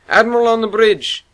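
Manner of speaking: high, loud announcement; called out. A narrator's reading. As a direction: call it across the bridge. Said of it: "Vabes called".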